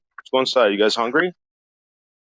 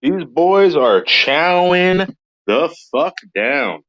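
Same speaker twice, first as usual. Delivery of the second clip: drawn out, higher; slow emphatic; 5 semitones higher, much slower than usual. Real, not acted. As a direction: stretch every word out.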